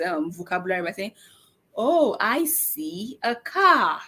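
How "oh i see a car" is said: put-on slow, clear model for a child; drawn-out demo to a toddler. Shown as a demonstration. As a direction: spell it out slowly for the little one. Parent models expanded word to child.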